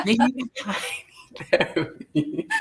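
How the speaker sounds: fast laugh, then breathy word; giggling self-tease. Real, not acted. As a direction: laugh it off, admitting you need help. Laughing admission after teasing.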